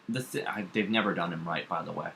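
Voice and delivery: ordinary talk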